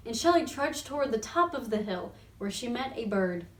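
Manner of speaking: plain reading voice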